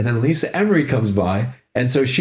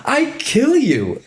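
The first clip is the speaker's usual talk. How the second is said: much higher, slow, teasing menace; playful mock threat; much slower than usual. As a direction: draw out a sing-song death threat as a joke. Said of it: "And she's like".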